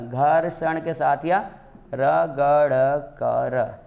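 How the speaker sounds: घर्षण and रगड़ कर drawn out slowly, the sounds rubbed; with friction. Shown as a demonstration. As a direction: slowly rub through रगड़ कर to show the friction. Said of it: "इसका उच्चारण होता है घर्षण के साथ". (घर्षण के साथ रगड़ कर धीरे-धीरे बोलें)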